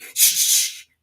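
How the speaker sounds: voiceless hush; hushing shh. A narrator's reading. Hatter and Hare hushing Alice, whispered. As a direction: shush her sharply.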